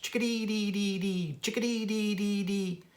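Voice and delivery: bright chickadee-dee-dee call. Acted. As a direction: chirp it out like a little chickadee. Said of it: "say their name".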